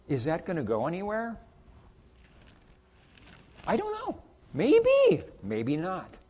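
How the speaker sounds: ordinary talk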